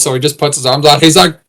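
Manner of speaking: normal talking voice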